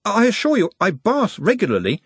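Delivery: high-pitched mild protest. A narrator's reading. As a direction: defend yourself earnestly. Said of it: "he protested".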